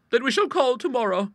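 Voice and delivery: high, firm maiden-lady voice; decisive. Acted. Bertha settling on tomorrow's call, decisive. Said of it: "with decision".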